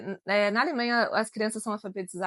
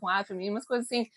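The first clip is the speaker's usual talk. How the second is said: usual speaking voice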